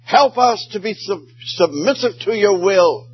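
high fervent storytelling; preacher's rising plea; impassioned preaching. Acted. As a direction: carry the story up with fervor. Preaching climax, story of Pollard.

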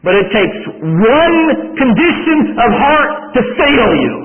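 high, loud, slow emphatic preaching. Acted. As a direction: drive the point home from the pulpit. Preaching at full force.

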